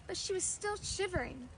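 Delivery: ordinary delivery, nothing marked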